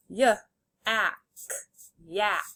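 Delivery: "Y, ack" as separate sounds, then "yak" whole; sounded out, blended. Shown as a demonstration. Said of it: "say the sounds and then blend".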